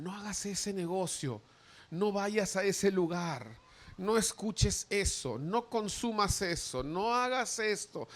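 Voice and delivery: slow, deliberate list of don'ts; slow conscience pleading with the listener; slow pleading warnings. Acted. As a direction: spell out each warning slowly.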